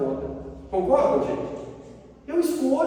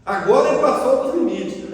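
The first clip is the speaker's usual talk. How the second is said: louder, firm declaration; emphatic indignation; 6 dB louder than usual. Retold or imitated. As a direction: raise the voice as the limit is crossed. Repeats his reaction, now emphatically. (indignação enfática, em voz alta)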